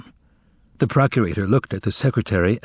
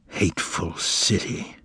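much softer, breathy mutter under the breath; 5 semitones lower, 6 dB quieter, slower than usual. A narrator's reading. Pilate mutters to himself about the city, soft mutter. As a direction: mutter it as if chilled. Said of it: "Pilate muttered suddenly".

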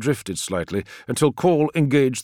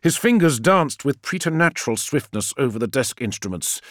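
plain reading voice

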